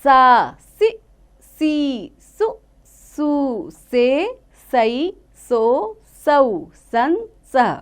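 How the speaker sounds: slow, drawn-out teacher's chant of each syllable; slow syllable recitation. Shown as a demonstration. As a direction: sound out every syllable slowly for children. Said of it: "उसकी मात्राओं को पढ़ेंगे". (धीमे-धीमे अक्षरों का उच्चारण)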